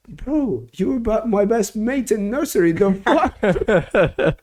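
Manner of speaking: high-pitched re-enacted astonishment; amazed recognition. Retells recognising nursery best mate.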